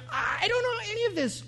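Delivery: very high, helpless protest; exasperated bewilderment. Retold or imitated. Patient baffled by his own treatment, high exasperated bewilderment. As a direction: squeak it out, completely at a loss.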